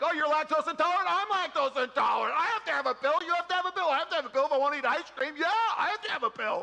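overexcited stranger bonding over a pill; gushing stranger. Retold or imitated. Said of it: "They're like".

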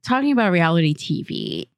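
normal talking voice

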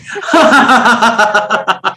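big open belly laugh; hearty demo laugh. Acted. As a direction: let a full laugh roll out.

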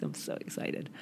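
breathy excited whisper. Real, not acted. Host confiding about her new house keys, excited whisper. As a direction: whisper it like a thrilled secret.

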